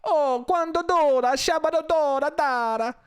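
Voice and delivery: high, drawn-out fake Italian gibberish; mock Italian. Comedian imitating gesturing Italians, high mock Italian. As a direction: belt out gibberish in a cartoon Italian accent. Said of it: "them gesturing like".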